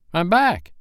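high, indignant call; hotly. A narrator's reading. Peter to the silent boys, hot indignant cry. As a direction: say it high with some heat. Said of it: "he said hotly".

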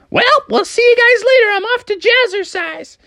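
very high, breezy mom voice; Froggy's mom. Acted. Mom leaves the family for jazzercise, high and breezy. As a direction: sing out a high-voiced goodbye. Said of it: "his mom was like".

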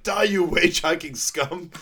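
villain attacking the Prime Minister, mock fury; villain battle cry. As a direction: spit the threat as he throws. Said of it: "He's like".

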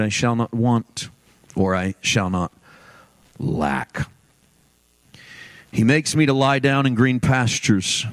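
low, measured scripture reading with long pauses; hushed psalm reading. Real, not acted. Preacher reading the Shepherd's Psalm to the congregation, low and measured. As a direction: read it reverently, pausing between phrases.

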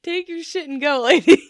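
half-laughing brush-off; laughing dismissal. Retold or imitated. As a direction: dismiss her through laughter, loud on the last word. Exasperated dismissal of the customer.

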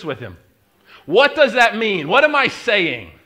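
loud rising pulpit questions; preacher building to a cry; preaching. Acted. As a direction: press the room hard. Preaching at full force.

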